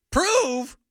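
prove said high and drawn out; stress on prove. Shown as a demonstration.

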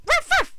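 high yappy little-dog ruff; dog bark imitation. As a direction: bark like a tiny poodle. Voicing the new poodle Lenny.

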